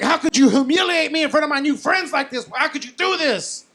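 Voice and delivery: high-pitched mock outrage; furious girlfriend. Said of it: "She was furious with me".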